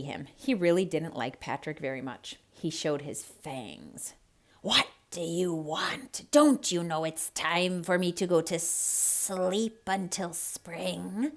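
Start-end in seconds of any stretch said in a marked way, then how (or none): 4.6-11.3 slow hissing snake voice; hissed. Acted. Snake hisses at Patrick at his den, slow hiss. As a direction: hiss it out, annoyed and sleepy. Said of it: "he hissed".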